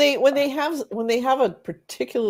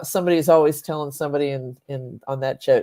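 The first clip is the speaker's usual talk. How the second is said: ordinary talk, nothing marked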